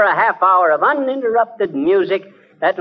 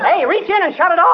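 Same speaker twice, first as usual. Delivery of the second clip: higher, urgent panicked call; frantic yell; 10 semitones higher than usual. Acted. McGee yelling at Molly to stop the wild phonograph, frantic yell. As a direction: shout over the chaos. McGee yells at machine chaos.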